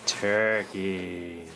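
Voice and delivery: very soft, drawn-out word; hushed reveal. Pretend. Presenting the turkey to viewers, hushed. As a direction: breathe it out low and reverently.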